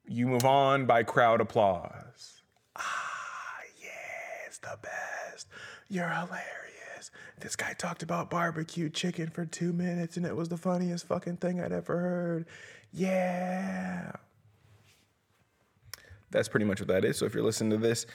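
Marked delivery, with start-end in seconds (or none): 2.8-5.5 hushed, breathy mock praise; mock whisper. 5.9-14.1 high-pitched gushing fan. Pretend. Imitating a gushing audience member.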